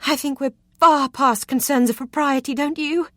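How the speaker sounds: a flirt mocking his caution; coy flirting. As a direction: bat your lashes with your voice. She flirts teasingly with him.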